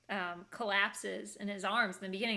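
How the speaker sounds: ordinary delivery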